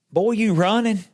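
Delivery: high-pitched quoted tease; high, lilting mock challenge; teasing question. Acting out pastor's teasing question.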